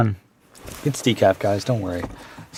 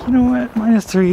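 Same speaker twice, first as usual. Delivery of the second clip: higher, lightly mock-carefree self quote; mimicking earlier self, brushing off the cold, higher; breezy confident self-quote; 11 semitones higher, 4 dB louder than usual. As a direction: imitate your past overconfident self, pitched up. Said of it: "and I was like".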